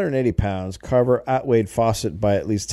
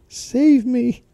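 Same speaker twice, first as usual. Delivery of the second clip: high, pitched-up imitation of a plea; mock dying plea; 14 semitones higher than usual. Shot man begs the bartender for help, mock high plea. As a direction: put on a much higher voice for the dying man.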